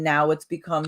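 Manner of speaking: ordinary talk, nothing marked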